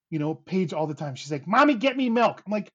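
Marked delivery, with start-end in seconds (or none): 1.5-2.4 high, loud imitation of a demanding kid; demanding child. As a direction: mimic a child ordering a parent around. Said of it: "you don't talk like that".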